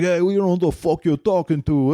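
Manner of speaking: put-on gruff New York accent, like The Sopranos; New York mobster accent, rough-voiced; thick New York accent. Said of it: "I'm gonna have a good time talking like Brennan".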